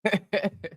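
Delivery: brief wordless chuckle; quick amused chuckle; short chuckle. Real, not acted. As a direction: let out a short laugh. Laughing at the live chat.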